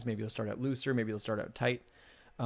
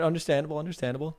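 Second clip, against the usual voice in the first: ordinary delivery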